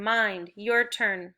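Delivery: usual speaking voice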